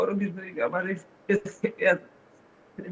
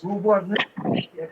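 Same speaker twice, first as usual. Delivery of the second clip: slow, sobbed-out words; real tearful breakdown; much slower than usual. Real, not acted. Father admitting he is helpless, crying. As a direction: drag it out through tears. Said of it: "কান্নায় ভেঙে পড়েছেন".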